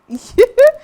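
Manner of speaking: loud high laugh breaking out mid-story; burst of laughter. Real, not acted. Telling co-hosts about a date, bursting into loud laughter.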